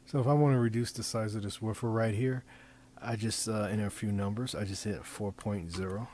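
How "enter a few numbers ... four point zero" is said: usual speaking voice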